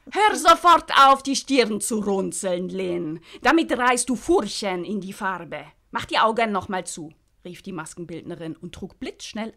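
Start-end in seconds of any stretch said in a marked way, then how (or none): 0.1-7.1 bossy makeup artist. Acted. Svetlana ordering Lynn to stop frowning, loud and sharp. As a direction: scold her firmly so the paint won't crack.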